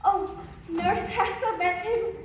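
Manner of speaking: high, breathless eager greeting; eager excitement. Acted. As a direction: rush to her, bursting with hope. Eager girl greets returning nurse.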